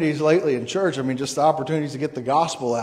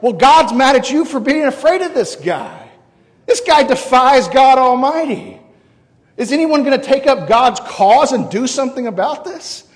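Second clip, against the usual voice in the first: loud, high-pitched indignant rebuke; rally them with raised, forceful voice; David; 7 semitones higher, 5 dB louder than usual. Acted. Answering the timid Israelites afraid of the giant, indignant rebuke.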